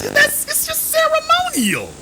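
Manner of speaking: very high squeaky mock reassurance; mocking falsetto. Mocking the Crown telling Jamaica it's nothing, in a squeaky high falsetto. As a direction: pipe it up high to mock their soothing.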